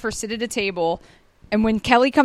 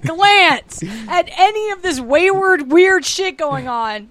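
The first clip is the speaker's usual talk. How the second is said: mock menacing; 4 semitones higher, 5 dB louder than usual. Joins mock director threat.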